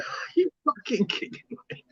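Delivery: high, soft, strained disbelief; can't believe what he's hearing; incredulous protest. Retold or imitated. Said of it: "I went".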